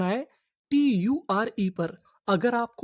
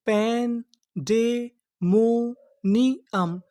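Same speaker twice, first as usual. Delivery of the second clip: very slow, broken into syllables; much slower than usual. Shown as a demonstration. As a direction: sound out पैन डे मो नी अम piece by piece. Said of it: "तो पूरी meaning क्या बनी?". (टुकड़ों में धीरे-धीरे पढ़ना)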